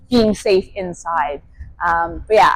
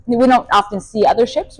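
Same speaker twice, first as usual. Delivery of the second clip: ordinary talk, nothing marked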